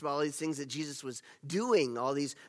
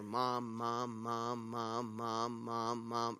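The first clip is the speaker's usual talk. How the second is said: a child's mock-whiny Mom, Mom, Mom, softer and lower, half-sung; persistent child nagging; 3 semitones lower, 8 dB quieter, much slower than usual. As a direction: nag like a little kid tugging at a sleeve, softly. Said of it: "like a child is persistent".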